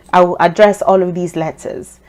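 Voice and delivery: ordinary talk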